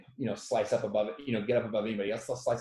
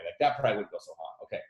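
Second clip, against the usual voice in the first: ordinary talk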